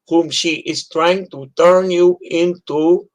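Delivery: a hint of a stern accented dad lecturing his son; father's broken English. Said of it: "especially with his broken English".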